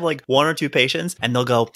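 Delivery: usual speaking voice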